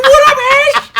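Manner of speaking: high, loud New York voice, laughing; loud, high mock New York greeting; New York caller imitation. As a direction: mimic the New York guy answering, hammed up. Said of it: "They be like".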